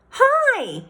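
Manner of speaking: high bright call of hi; a boy calling across the room. A narrator's reading. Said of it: "he called out".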